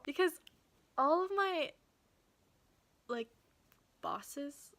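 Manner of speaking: ordinary talk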